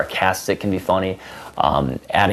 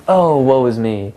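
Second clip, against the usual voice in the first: slow, higher mock-tragic lament; overly dramatic; 5 semitones higher, 3 dB louder, slower than usual. Shown as a demonstration. Demo of overly dramatic talk, mock lament. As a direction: ham up the self-pity. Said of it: "being overly dramatic about things like".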